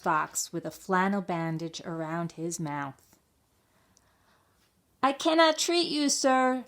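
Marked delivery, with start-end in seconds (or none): 5.0-6.6 high, loud refusal through the door; shout. A narrator's reading. Mouse dentist refusing the fox, shouting. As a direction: call out firmly from the window. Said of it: "Doctor DeSoto shouted".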